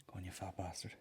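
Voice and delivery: whispered under the breath; barely audible egging-on to himself; hushed muttering. Real, not acted. Man searching for a [name] video, whispering.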